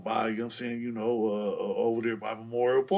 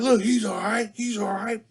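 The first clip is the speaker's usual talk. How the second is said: higher, louder mock pleading; calm her down fast, pitched up; Richard pleading; 10 semitones higher, 6 dB louder than usual. Richard pleading with angry auntie, mock pleading. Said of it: "Richard's like".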